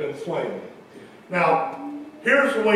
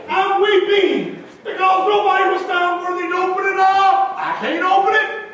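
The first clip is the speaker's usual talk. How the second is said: higher, pleading wail of a weeping man; John weeping over the sealed book, wailing high; 12 semitones higher, 5 dB louder than usual. Acted. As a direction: cry it out high and anguished. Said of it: "He says".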